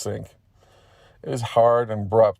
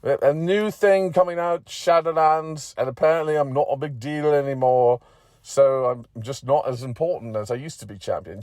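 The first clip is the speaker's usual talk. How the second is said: higher-pitched character voice, settling as it goes; McGeady, demoted and explaining; 6 semitones higher than usual. Acted. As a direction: explain your comedown in character.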